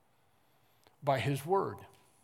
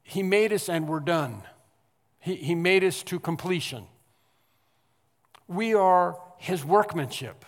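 louder, building preacher's cry; rising preaching; 3 semitones higher, 6 dB louder than usual. Acted. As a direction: lift it to the throne. Preaching builds to full force.

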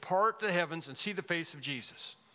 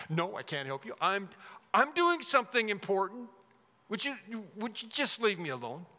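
mock self-important annoyance, pitched up; irritated refusal; 4 semitones higher than usual. Busy person refusing someone's plea for help, mock irritated. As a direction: fob them off, flustered and put out.

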